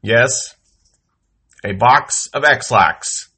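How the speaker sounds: usual speaking voice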